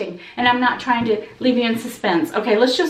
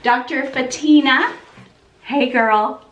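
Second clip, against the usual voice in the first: ordinary delivery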